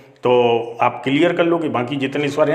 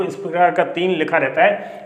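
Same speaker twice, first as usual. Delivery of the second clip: ordinary delivery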